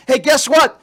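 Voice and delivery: usual speaking voice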